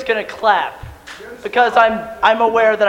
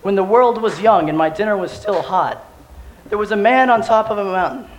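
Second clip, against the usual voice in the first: story narration; 6 dB quieter than usual. Acted. Mock-epic legend narration.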